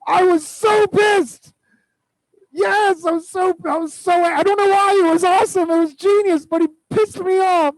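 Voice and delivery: high, loud, slow exasperated ranting; mock furious rant. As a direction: act out being furious at getting fooled. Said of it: "I'm like".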